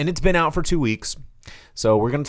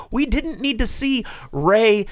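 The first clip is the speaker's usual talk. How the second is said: ordinary talk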